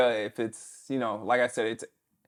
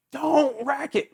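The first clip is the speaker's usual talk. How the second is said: coach yelling; 13 semitones higher, slower than usual. Retold or imitated. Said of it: "And he's just telling you".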